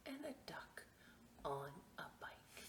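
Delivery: hushed whisper for the surprise; whispered reveal. Acted. Reading to children, whispering the last twist. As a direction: whisper it like a secret.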